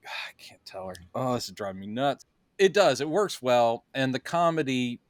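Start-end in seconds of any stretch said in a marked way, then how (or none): 0.1-2.2 much softer, frustrated under the breath; muttering to himself. Retold or imitated. Clark privately fretting that he can't tell Lois, softer mutter. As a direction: grumble it to yourself, barely voiced. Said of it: "he's just sitting there going".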